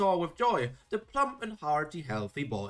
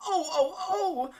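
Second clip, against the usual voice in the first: put-on high, pained little-boy cries of oh; a boy yelping as his thumbs are cut; crying out in pain; 14 semitones higher than usual. A narrator's reading. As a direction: wail each oh higher as it hurts. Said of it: "and Conrad cried out".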